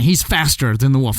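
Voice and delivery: ordinary delivery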